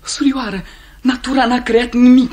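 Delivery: "surioară" high, rising into loud rapture; rapturous. Acted. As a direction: swell into enraptured praise. Lover's rapture.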